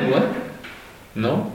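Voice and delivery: usual speaking voice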